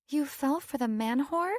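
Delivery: confused rising question. A narrator's reading. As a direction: ask it in disbelief. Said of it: "voice laced with confusion".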